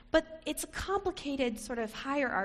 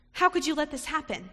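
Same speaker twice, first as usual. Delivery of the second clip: higher, louder mock scolding; 4 semitones higher, 6 dB louder than usual. Preacher voicing Elizabeth scolding Mary, angry. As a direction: scold her in disbelief. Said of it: "scolded her".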